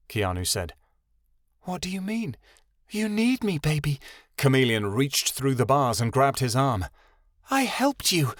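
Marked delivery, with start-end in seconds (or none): 1.6-4.0 higher, sweet-talking voice; coaxing girl. A narrator's reading. Chameleon voice. 7.5-8.3 higher, sweet-talking voice; coaxing girl. A narrator's reading. Chameleon voice.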